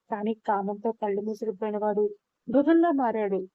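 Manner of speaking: plain reading voice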